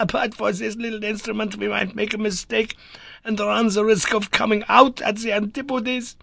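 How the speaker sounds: giddy laughing professor; unnatural laughter. A narrator's reading. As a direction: laugh it out a bit too wildly. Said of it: "amid bursts of unnatural laughter".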